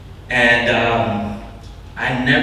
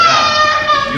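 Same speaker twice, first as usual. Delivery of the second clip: delighted ah; 6 dB louder than usual. Retold or imitated. Retold realization.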